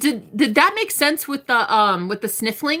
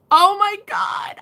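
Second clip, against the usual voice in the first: high, slowed exclamation of surprise; sudden startled gasp of words; startled oh my God; 10 semitones higher, 4 dB louder, slower than usual. Real, not acted. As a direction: react to a sudden noise. Startled by a train outside.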